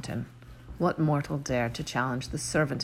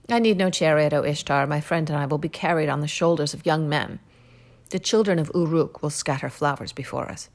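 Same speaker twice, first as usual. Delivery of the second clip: louder, firm heroic voice; proud refusal; 4 dB louder than usual. Acted. Gilgamesh refuses Ishtar's chariot, louder and firm. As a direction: turn her gift down with pride.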